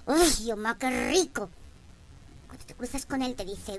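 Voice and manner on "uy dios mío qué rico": much louder excited gasp; swooning delight. Retold or imitated. Proud woman secretly swooning at a handsome man, loud and excited. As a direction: gush over the hunk.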